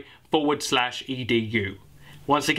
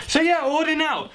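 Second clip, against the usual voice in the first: flustered; 12 semitones higher, 3 dB louder than usual. Real, not acted. Awkward sign-off after failed wink.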